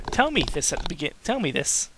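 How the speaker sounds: ordinary talk, nothing marked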